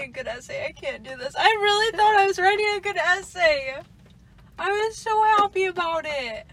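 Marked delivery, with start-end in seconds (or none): 1.4-6.5 overdramatic, higher and louder; dramatic. Shown as a demonstration. Rereading her own text to her friend, hammed-up dramatic. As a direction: ham up the despair of a ruined essay. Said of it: "I sound so dramatic".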